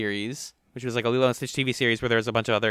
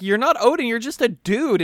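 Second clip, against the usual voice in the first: high, loud mocking disbelief; mock incredulous retort; 10 semitones higher, 6 dB louder than usual. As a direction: scoff it at him high and loud. Characters retorting to Odin.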